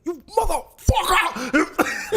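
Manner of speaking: high, slow, laughing yell; mock furious outburst. Father exploding at his reckless son, high mock yell.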